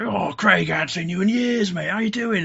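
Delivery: high, loud surprised greeting; acted delighted reunion. Inmate reunited with a friend after years, loud acted delight. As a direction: imitate a mate thrilled to see someone.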